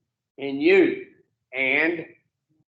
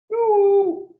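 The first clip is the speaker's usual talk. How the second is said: sudden high ooh at a listener; pitched-up startled exclamation; surprised ooh; 12 semitones higher, 4 dB louder than usual. Real, not acted. Speaker interrupts story to notice listener.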